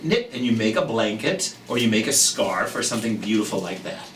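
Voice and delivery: ordinary delivery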